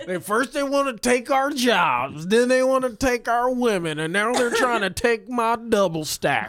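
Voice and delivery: a laughing caricature of an angry suburban dad; mocking bigot voice. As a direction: ham up the paranoid dad.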